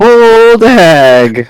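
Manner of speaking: high cackling hag; old hag voice. Pretend. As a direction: squeak it like an old crone. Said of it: "I went a bit hag there".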